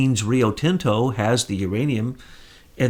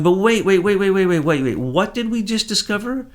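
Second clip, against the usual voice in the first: excited realization; 8 semitones higher than usual. Retold or imitated. Realizing the British role mid-research, excited higher stammering. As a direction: blurt it out as the penny drops. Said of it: "you're looking and you're going".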